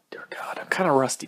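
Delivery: breathy sighing whisper; a tired aside, barely voiced. Real, not acted. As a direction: sigh it out under your breath. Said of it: "unnecessary sounding noises, voices".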